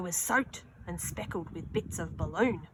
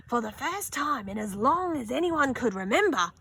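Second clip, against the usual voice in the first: plain reading voice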